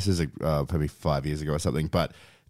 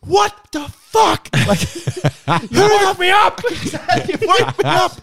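very high loud mock yell with laughs; yelling at the top of voice; 7 dB louder, slower than usual. Housemate yelling at the door-knocking salesman, very high mock yell. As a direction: shriek it slowly like a woken housemate. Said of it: "yelling at the top of his voice".

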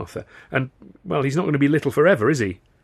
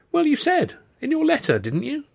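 very high, plaintive comeback; defensive protest. A narrator's reading. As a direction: plead your case on the defensive.

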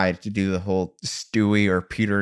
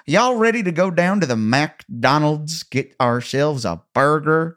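higher, exaggerated foreigner's Southern accent; imitated Southern accent; 6 semitones higher, 3 dB louder than usual. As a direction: botch a Southern American accent with gusto. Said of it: "asked her to do a Southern American accent".

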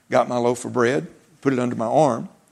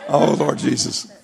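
laughing exclamation; slower than usual. Real, not acted. Preacher recovering from laughter after his story. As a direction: laugh it off, slow and breathless.

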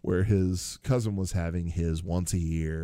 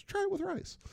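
much higher, softer put-on Apu voice; Apu punchline; 7 dB quieter than usual. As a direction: deliver the punchline in a high soft voice. Apu's punchline.

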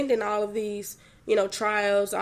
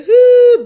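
loud, high drawn-out "hoo" of crying; playful wail through real tears; boo-hoo wail; 13 semitones higher, 18 dB louder than usual. Shown as a demonstration. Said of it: "boo-hoo tears".

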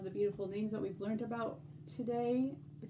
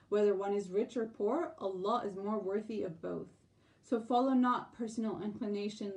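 ordinary delivery

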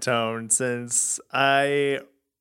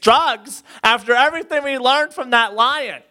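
higher, louder hammed-up disbelief; mock naive outrage; 6 semitones higher, 4 dB louder than usual. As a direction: play a scandalized high-schooler, building louder. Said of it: "and thinking".

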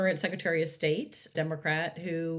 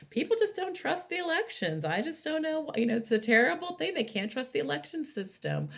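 high, sing-song mock concern; lightly mock a fake-worried politician; imitating Kari Lake's innocent worry, pitched high; 9 semitones higher than usual. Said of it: "And then says".

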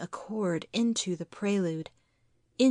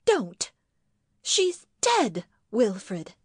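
distressed cry; 2 semitones higher, 3 dB louder, slower than usual. Acted. Wife reproaches husband about dead mother, crying out. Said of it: "she cried".

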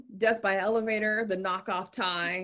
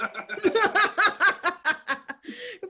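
loud high cackle of laughter; 12 semitones higher, 6 dB louder than usual. Real, not acted. Host laughing at a hated character's death, loud cackle.

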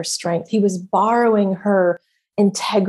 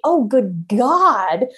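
ordinary talk

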